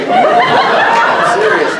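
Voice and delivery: high, drawn-out laughing squeal. Real, not acted. Joking about weird fan fiction.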